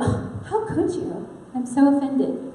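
put-on "how dare you" hurt; mock offended. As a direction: play wounded indignation, hammed up. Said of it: "he's gonna be like".

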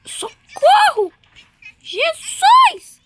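high loud cry for help; panicked scream. Real, not acted. Scared in game.

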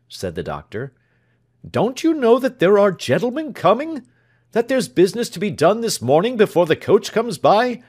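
high, loud exasperated scolding; doctor berating his man before guests. Acted. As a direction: scold the servant for his delay.

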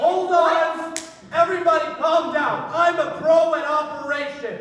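high, loud frantic order; commanding shout. Acted. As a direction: shout over a panicking group. Takes charge amid the panic.

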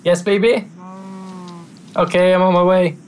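answering partner. Real, not acted. Gamer answers the missus mid-game, high-pitched call back.

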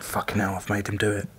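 low, hushed realisation; aghast aside. Retold or imitated. Teammate realising he made him do it, hushed. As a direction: say it very softly, as if to yourself. Teller's inner dread as friend sings.

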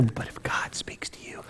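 hushed conspiratorial whisper. Acted. Preacher confiding to congregation, in a whisper. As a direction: lean in and breathe it like a secret. Mock secret aside.

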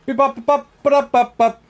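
high playful "beep bop" noises; robot beeps. Pretend. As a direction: make silly machine sounds. Imitating game noise.

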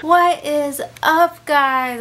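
slow loud drawn-out greeting; hype greeting. Real, not acted. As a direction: stretch out the hello. Greeting viewers.

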